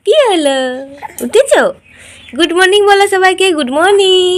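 high, loud sing-song coaxing. Real, not acted. Mother coaxing baby to greet.

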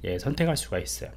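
ordinary talk

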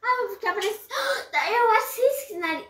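sudden eager burst; excited. Real, not acted. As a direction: blurts it out thrilled. Child excited idea, gasps.

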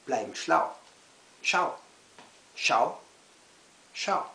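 short punched phrases with pauses; emphatic poem recital. Acted. Poet reads snail poem to children, emphatic recital. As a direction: declaim it line by line, hitting key words.

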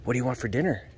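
ordinary talk

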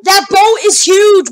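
high, loud, drawn-out wonder; amazed exclamation. Real, not acted. Gamer repeating awe at a giant boat, amazed exclamation. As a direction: marvel loudly, stretching the words.

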